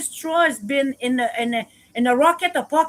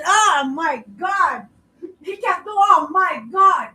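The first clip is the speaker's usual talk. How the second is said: smelling something awful; 4 semitones higher than usual. Real, not acted.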